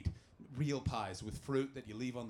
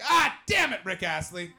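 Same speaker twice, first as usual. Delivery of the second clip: loud, high burst of annoyance; hammed-up cursing yell; mock exasperated shout; 5 semitones higher, 12 dB louder than usual. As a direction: explode on the first words, then ease off. Acted outburst at the prank.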